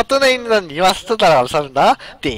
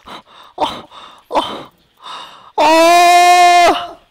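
dismayed cry; 9 semitones higher, 6 dB louder, much slower than usual. Real, not acted. Losing in the game.

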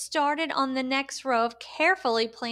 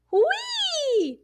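high, joyful squeal of delight; gleeful whee. Acted. Ika riding the elephant, squealing with high delight. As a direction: squeal it like a child flying through the air. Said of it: "said Ika with a huge grin".

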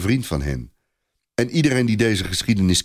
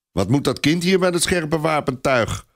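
raised, angry knight; angry bark; 7 semitones higher than usual. A narrator's reading. Du Guesclin scolding about the child, angry. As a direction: bark it at the boy's presence. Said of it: "bast du Guesclin boos".

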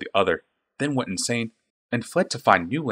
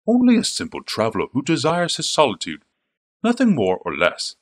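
assured and amused; unbothered, a smile in the voice; confident, smiling voice; 6 semitones higher than usual. A narrator's reading. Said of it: "strong and confident, the smile in his voice".

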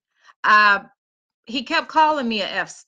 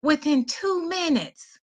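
usual speaking voice

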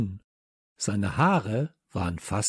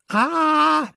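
high, loud drawn-out "aah"; startled scream; 7 dB louder than usual. A narrator's reading. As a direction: scream out high in sudden fright. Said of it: "riefen Samson, Leo und ich". (erschrockener, hoher Schrei)

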